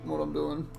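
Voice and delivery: chewing while talking; quick words round a bite of food; mouth full. Real, not acted. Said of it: "while I'm chewing food".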